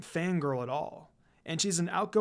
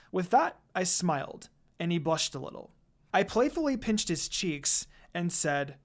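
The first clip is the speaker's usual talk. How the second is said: ordinary delivery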